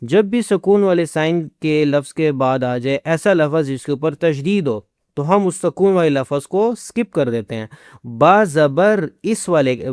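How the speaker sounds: ordinary talk, nothing marked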